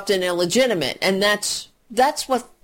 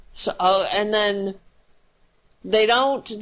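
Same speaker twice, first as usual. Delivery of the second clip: ordinary talk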